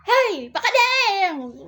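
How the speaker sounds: high, loud, slow drawn-out call with a laugh; mock yell. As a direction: holler it stretched out, half laughing. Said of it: "Sabi, sabi niya". (pabirong sigaw, mahaba at malakas)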